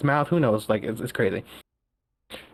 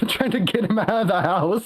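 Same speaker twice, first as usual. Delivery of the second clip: laughing through the words; 6 semitones higher than usual. Real, not acted. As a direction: giggle while telling it. Laughing while telling the raid.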